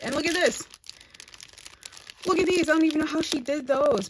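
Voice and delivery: delighted squeal over a handmade gift; excited gushing. Real, not acted. Gushing over handmade swap gifts.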